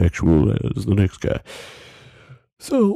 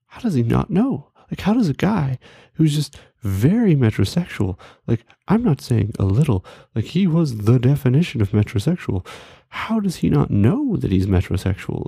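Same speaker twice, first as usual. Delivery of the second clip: higher-pitched, emphatic disbelief; incredulous; 6 semitones higher than usual. Retold or imitated. Retelling his shock at friend's reaction, incredulous. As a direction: marvel at how he can't know.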